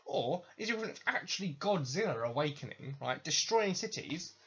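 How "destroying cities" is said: ordinary talk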